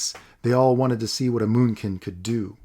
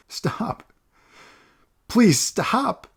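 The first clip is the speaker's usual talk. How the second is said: high, desperate plea; boy begging Olaf to stop; whimpering plea; 11 semitones higher, 3 dB louder, much slower than usual. Acted. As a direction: beg him tearfully to stop. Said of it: "Mao whimpered".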